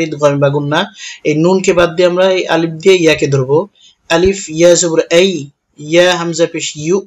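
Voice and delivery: ordinary talk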